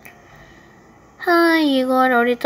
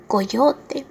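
normal talking voice

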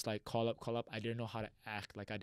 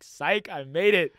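loud, high, drawn-out gotcha; spring the surprise at full volume; big slow triumphant reveal; gleeful prank reveal; 5 semitones higher, 14 dB louder, much slower than usual. Retold or imitated.

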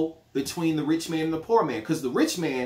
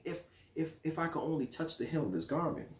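scheming aside; 4 semitones lower, 9 dB quieter than usual. Retold or imitated. Family member voiced, greedy whisper.

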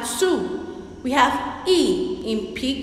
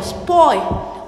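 ordinary talk

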